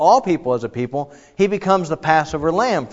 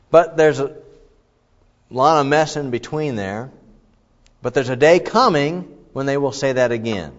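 ordinary delivery

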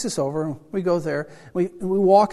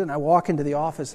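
normal talking voice

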